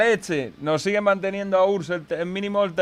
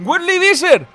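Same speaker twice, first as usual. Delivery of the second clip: excited outburst; 13 semitones higher, 6 dB louder than usual. Real, not acted. Streamer reacting to surprise winner.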